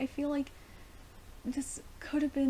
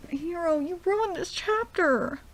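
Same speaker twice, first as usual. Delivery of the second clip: high, loud, slow lament; real dismay, stretched out and pitched up; exasperated wail; 9 semitones higher, 7 dB louder, slower than usual. Real, not acted. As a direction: wail the complaint at the author. Berates author over revival.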